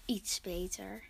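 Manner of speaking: soft breathy whisper. Real, not acted. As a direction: whisper it aside. Whispered aside after intro.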